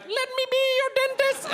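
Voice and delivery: put-on very high, piping little girl; comic little-girl falsetto. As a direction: pipe it up like a small girl. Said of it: "pretending I'm a little girl".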